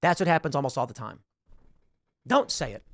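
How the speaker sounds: ordinary delivery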